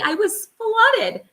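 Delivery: "flooded" drawn out, high and loud; stress on flooded. Real, not acted. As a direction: lean hard on "flooded". Stressing too much hormone.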